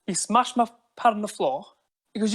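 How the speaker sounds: ordinary delivery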